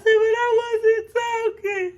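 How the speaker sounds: very high, slow whiny wail; little girl wailing her innocence to grandma; crying child protest. Retold or imitated. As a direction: plead like a wronged little girl. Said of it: "I said".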